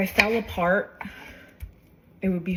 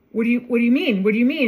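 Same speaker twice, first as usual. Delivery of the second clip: mother's alarmed questions, somewhat louder; imitating a mother freaking out, a bit louder and higher; retold panicked mother; 5 semitones higher, 7 dB louder, faster than usual. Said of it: "she was, like, freaking out".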